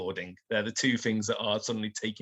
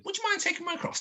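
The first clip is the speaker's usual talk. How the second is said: high, sugary barmaid; sweet barmaid voice; faster than usual. Acted. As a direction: sweetly coax a favour. Barmaid asks a favour.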